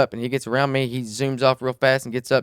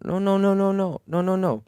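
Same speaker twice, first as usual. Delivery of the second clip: much higher, hurried mock denial; a hint of the panicked stranger pleading no; imitating the other driver; 7 semitones higher than usual. The drunk driver denying to the angry Jeep owner, higher mock plea.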